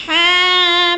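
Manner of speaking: हामीम chanted high and loud, last मीम hidden in the nose; recitation with ghunna. Shown as a demonstration. Teacher showing the nasal मीम. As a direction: hum the final मीम nasally. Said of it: "आवाज़ को नाक में छुपा कर पढ़ना".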